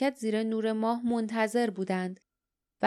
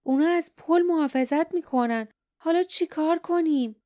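mildly angry, pitched higher; raised pitch with a touch of anger; 7 semitones higher, 4 dB louder than usual. A narrator's reading. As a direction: read it with contained, exasperated anger. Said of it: "باردا با عصبانیت گفت".

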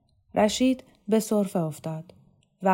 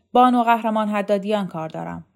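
restrained shout, louder than usual; shouted demand; 2 semitones higher, 7 dB louder than usual. A narrator's reading. Caller demands a woman officer over the telephone, mildly shouting. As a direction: call it out as a loud demand into a phone. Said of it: "وهاب داد کشید".

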